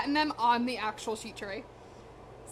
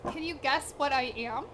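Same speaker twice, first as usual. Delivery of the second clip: normal talking voice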